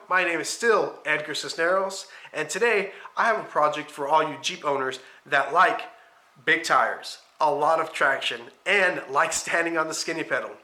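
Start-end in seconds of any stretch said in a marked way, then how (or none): none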